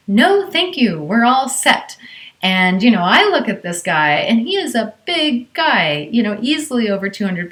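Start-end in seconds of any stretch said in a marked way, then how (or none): 0.1-2.0 firm, raised no-nonsense refusal. Retold or imitated. Mother to stranger offering help, firm higher refusal. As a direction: shut him down firmly. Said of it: "says in a very firm voice".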